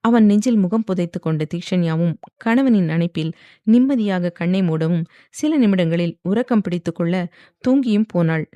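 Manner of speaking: plain reading voice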